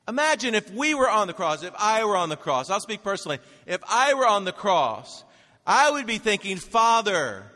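high, loud sermon delivery; impassioned preaching. Real, not acted. Preacher to congregation on forgiveness at the cross, impassioned.

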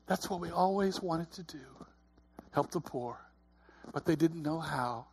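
quietly recounted words of a tearful mother; hushed retelling. Retold or imitated. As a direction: quote her tenderly, under your breath.

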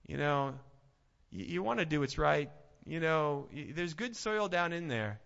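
usual speaking voice

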